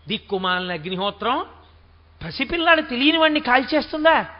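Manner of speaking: usual speaking voice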